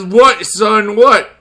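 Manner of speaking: loud, high goofy voice; Mister Bacon voice. Pretend. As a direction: shout it as the toy. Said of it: "Mister Bacon says".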